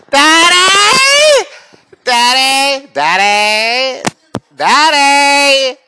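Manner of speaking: put-on high loud kid calling daddy over and over; child calling. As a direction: whine for daddy like a small child.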